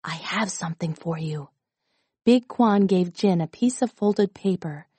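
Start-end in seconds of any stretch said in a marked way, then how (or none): 0.0-1.5 low, hushed and secretive; whispered. A narrator's reading. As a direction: drop to a soft, low near-whisper. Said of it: "he looked around and whispered".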